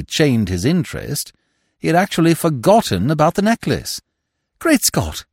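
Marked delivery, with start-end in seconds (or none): 4.6-5.3 sudden higher-pitched exclamation; startled realisation, pitched up; exclaiming. A narrator's reading. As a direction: exclaim as if it just struck you. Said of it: "he exclaimed".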